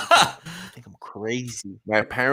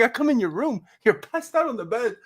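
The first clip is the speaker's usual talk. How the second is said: high incredulous cousin voice; mock bewildered, pitched high; imitating cousin; 9 semitones higher than usual. Cousin finds him asleep with phone on, high and incredulous. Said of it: "then he's like".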